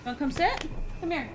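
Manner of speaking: high-pitched pet talk; coaxing the cat. Real, not acted. Owner invites the cat to sit, high coaxing. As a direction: coax a pet over in a high voice.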